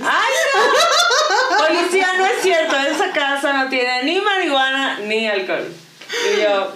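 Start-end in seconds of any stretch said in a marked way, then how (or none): 0.0-5.7 much higher, loud, laughing denial to the police; panic-laugh your cover story; hammed-up laughing alarm; laughing mock panic.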